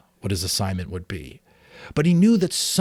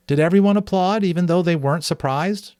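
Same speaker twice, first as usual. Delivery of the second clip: put-on curious boy; 9 semitones higher, 4 dB louder than usual. Boy Jonas asks his father.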